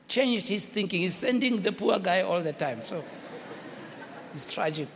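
usual speaking voice

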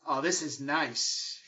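drawn out slowly, savoring it. Acted. Luciano settles into the seat, savoring it slowly. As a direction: stretch every word like sinking into leather.

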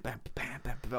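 soft mouthed horn stabs; imitated jazz band. Conductor bobbing away to the band, softly scatted jazz. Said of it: "all this jazz going on".

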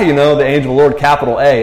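normal talking voice

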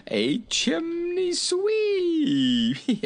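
high merry voice breaking into squeals of laughter; helpless high-pitched hilarity; jolly king, overcome with giggles. A narrator's reading. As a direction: start amused, then collapse into high giggling.

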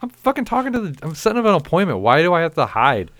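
high, loud exasperated retort. Retold or imitated. Snapping back at the coworker, high and loud. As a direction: fire back, fed up.

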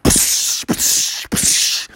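hissed splash effects; splashing noises. Acted. Storyteller imitating Buckaroo crossing water, hissy splashes.

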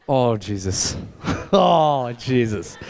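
low, drawn-out groan over the food; hammed-up moan of pleasure at dinner; mock moan of delight. Preacher mocking a diner's moan over steak on a date. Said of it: "be like".

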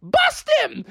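very high, urgent order; battle command. Acted. Mommy orders kids to blast the ghost, commanding. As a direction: squeal the command sharply. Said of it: "Mommy Frog pulled out her proton pack and was like".

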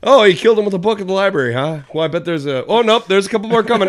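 high, loud, rapid-fire reaction; play-by-play viewer. Acted. Acting out a drinking game during the movie, rapid excited commentary.

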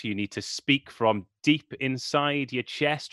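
ordinary talk, nothing marked

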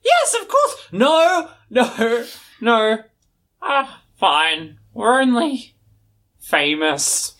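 flustered pirate. Acted. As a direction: stumble from yes to no, then admit it.

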